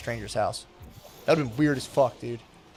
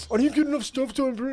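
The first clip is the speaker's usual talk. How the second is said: high whiny mock of himself; mocking own whiny voice; 11 semitones higher than usual. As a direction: whine it like a fretting kid. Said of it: "and be like".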